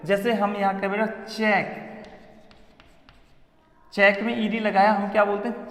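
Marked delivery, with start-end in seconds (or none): none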